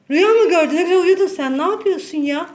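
loud, high exasperated scolding; yelling at the cat. Real, not acted. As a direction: tell off a cat that woke up grumpy. (kediye bağırarak çıkışıyor)

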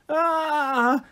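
long, high mock scream; acted scream. A narrator's reading. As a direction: hold a high frightened shriek, slightly restrained. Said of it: "Chloe screamed".

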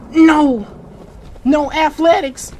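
usual speaking voice

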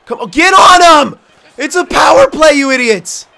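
very high, loud furious yelling; angry shout. Real, not acted. Gamer furious at AI teammates on the power play, angry shout. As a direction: scream at the useless teammates.

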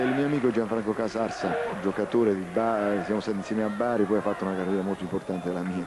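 normal talking voice

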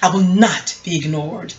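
slow, menacing movie line; a hint of the film's stalker, slowed down; Fatal Attraction quote. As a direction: deliver it like the obsessed lover. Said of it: "that Fatal Attraction scene in the movie when she told".

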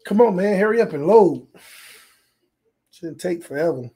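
ordinary talk, nothing marked

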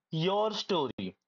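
"your story" said slowly, each word weighted; slow emphasis. Real, not acted. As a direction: lean on "your story", drawn out. Emphasising the key point.

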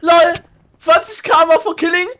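very high, loud, slow crowing; gleeful disbelief. Real, not acted. Player realizing whom he just killed, very high loud delighted disbelief. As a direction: crow in shocked delight.

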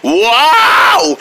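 high, drawn-out shouts; hollered greeting. Acted. Bursting in on the drone monkey, hollered greeting. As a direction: stretch every word in a big whooping call.

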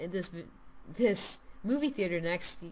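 ordinary delivery

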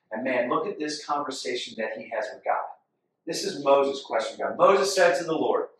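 ordinary talk

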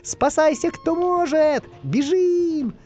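very high, stretched alarm cries; panicked wail. A narrator's reading. Spy warns friends of the living dead, high panicked wail. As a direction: wail each warning high and long. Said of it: "во всё горло завопил Дырка".

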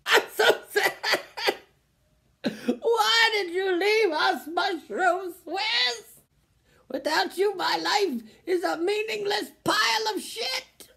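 high, wailing mock grief, crying out; overacted sob over a lost sandwich; hammed-up sobbing. Mourning the discontinued Mushroom Swiss sandwich, hammed-up wailing sob. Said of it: "Can you be a little more dramatic".

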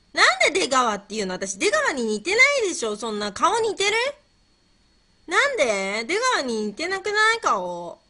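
loud, high-pitched objection; indignant protest. Real, not acted. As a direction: protest the comparison loudly. Protests being likened to Degawa.

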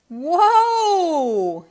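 high, loud stretched whoa; yelled. A narrator's reading. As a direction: call after her. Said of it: "yelled Joe".

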